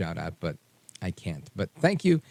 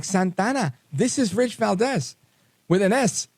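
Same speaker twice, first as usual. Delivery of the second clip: mock excited intro; 11 semitones higher, 3 dB louder than usual. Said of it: "she's like".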